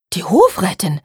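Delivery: mild alarmed exclamation; startled. A narrator's reading. Charlotte learns the Hofrätin is coming, startled. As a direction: catch yourself in sudden dismay. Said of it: "rief Charlotte erschrocken".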